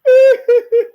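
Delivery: big high cackling laugh; loud cackle. Real, not acted. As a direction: burst into a loud mocking cackle. Mocking laugh.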